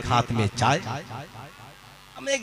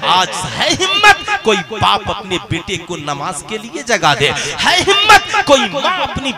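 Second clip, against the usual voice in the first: high, loud sermon cries to the crowd; fiery preaching; 10 semitones higher, 8 dB louder than usual. Acted. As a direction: rouse the congregation, shouting. Preaching at full force.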